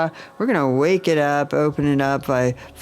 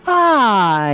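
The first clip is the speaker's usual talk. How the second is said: high, louder open ah; sung sigh; 9 semitones higher, 6 dB louder than usual. Shown as a demonstration. Teacher showing the heart chakra sigh. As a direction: release a big ringing ah. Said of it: "Ah is the exercise for it".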